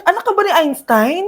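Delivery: ordinary talk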